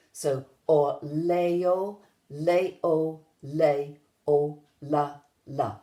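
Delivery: very slow, measured spoken beat; slow, in rhythm. Shown as a demonstration. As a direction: chant it slowly, syllable by syllable. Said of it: "take that phrase and say it slowly, but in rhythm".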